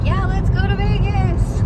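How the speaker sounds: high put-on voice of an excited mom; imitating mom. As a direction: play mom jumping at the idea of Vegas. Voicing her mom's excited reply.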